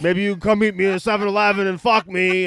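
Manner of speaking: mock a crass Tinder match in a put-on accent; crude Tinder guy. Host mocks crude Tinder messages in a hearty put-on accent. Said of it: "that was a pretty hearty accent".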